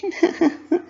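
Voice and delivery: high light giggle; cute giggle. A narrator's reading. Pinkie answering Dash with a cute high giggle. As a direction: giggle sweetly. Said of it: "a cute laugh".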